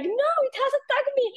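high, urgent retelling; squeaky, frightened mock insistence; panicked insistence. Insisting to friend.

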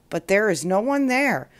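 ordinary delivery, nothing marked

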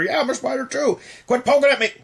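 high, annoyed spider voice; squeaky irritated critter complaining; exasperated poked game character. Pretend. Voicing second spider, annoyed.

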